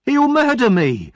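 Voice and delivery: high, loud panicked scream; terrified scream. Acted. Noah screams under attack from Oliver, high and loud. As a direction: scream it as you're being throttled. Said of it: "screamed Noah".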